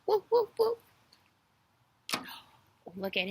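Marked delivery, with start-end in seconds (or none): 0.0-0.8 high, short puppy woofs; playful high-pitched bark imitation. Shown as a demonstration. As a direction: yip like a little puppy. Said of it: "What sound does a puppy make".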